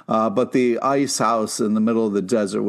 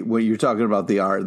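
usual speaking voice

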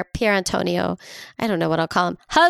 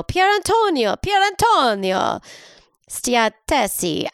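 put-on high, sing-song Italian accent; showy Italian accent; 6 semitones higher than usual. Host showing off an Italian name, hammed-up Italian accent. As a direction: roll it out in hammed-up Italian, slowly. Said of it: "I love Italian".

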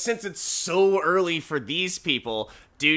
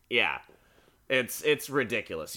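ordinary talk, nothing marked

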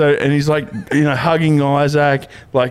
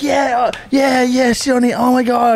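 over-the-top stranger; 12 semitones higher, slower than usual. Retold or imitated. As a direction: gush at him like a pushy drunk. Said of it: "and like".

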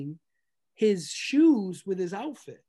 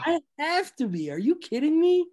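higher and louder, fired up; excited; 9 semitones higher, 6 dB louder than usual. Real, not acted. Guest raving about the Malice at the Palace, excited. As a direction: insist it with glee.

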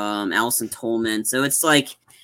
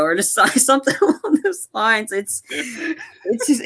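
high, breaking into real giggles; words falling apart into laughter; laughing while talking; 10 semitones higher than usual. Real, not acted. Podcaster losing it after their own impression, laughing.

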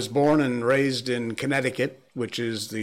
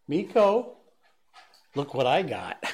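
calling the dog; 2 semitones higher than usual. Real, not acted. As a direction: call the dog in, pitched up.

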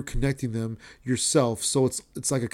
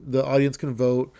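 usual speaking voice

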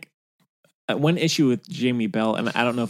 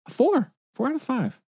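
excited. Real, not acted. Teasing co-host with rating.

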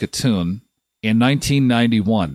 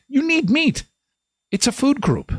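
high mock insistence; 12 semitones higher than usual. Mock preaching about meat.

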